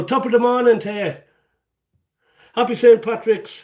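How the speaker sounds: lightly hammed-up Irish lilt; Irish accent. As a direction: give it a cheery Irish-accent greeting. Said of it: "I got the accent".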